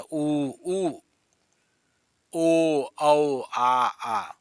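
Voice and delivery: lips rounded, vowels held out loud and clear; rounded vowel demo. Shown as a demonstration. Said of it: "इनको बोलते समय".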